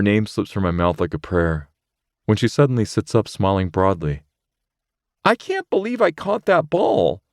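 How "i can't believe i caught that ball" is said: put-on higher, lighter voice for the girl, cheerful; Rachel's voice. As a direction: voice Rachel, pleased with herself. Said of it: "she laughs".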